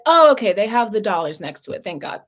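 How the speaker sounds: ordinary delivery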